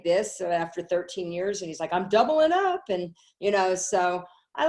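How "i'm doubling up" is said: higher, louder, pumped-up vow; eager declaration. Retold or imitated. Her brother finally saying yes, a loud eager vow. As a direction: announce it like a fresh convert.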